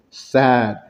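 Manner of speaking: low, downcast demo of sadness; sad. Shown as a demonstration. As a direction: say it low and sorrowful. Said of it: "range of emotions".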